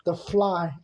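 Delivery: normal talking voice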